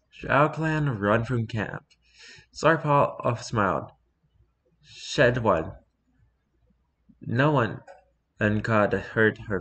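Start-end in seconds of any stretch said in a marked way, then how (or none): none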